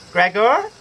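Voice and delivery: mother calling through door. A narrator's reading. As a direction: call gently through the door. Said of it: "a voice called".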